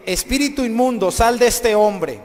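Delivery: loud, high, authoritative order, slowing down; exorcist's command. Retold or imitated. Jesus orders the unclean spirit out, commanding shout. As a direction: cast it out with full force.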